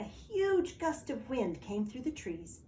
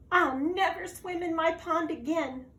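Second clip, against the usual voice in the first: sniffling; 8 semitones higher, 4 dB louder than usual. Acted. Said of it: "sniffed Frog".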